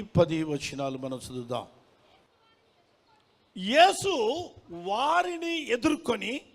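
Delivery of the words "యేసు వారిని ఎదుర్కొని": slow, high, loud proclamation; declaimed scripture reading. Acted. Preacher reading Matthew to the congregation, declaimed scripture. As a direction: proclaim the verse phrase by phrase.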